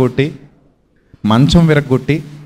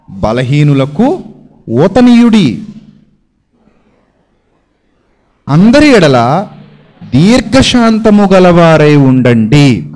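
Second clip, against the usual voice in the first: slow, louder, weighty verse read out word by word; solemn scripture reading; 4 dB louder, slower than usual. Shown as a demonstration. Preacher reading the Bible verse aloud to the congregation, slow and solemn. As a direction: lay each word of the verse down slowly and firmly. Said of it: "Bible చెప్తోంది చాలా స్పష్టంగా".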